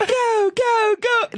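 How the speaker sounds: high cheering go go go; mock cheering. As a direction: cheer someone on from the crowd. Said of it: "I was just like".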